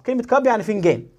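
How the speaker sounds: ordinary delivery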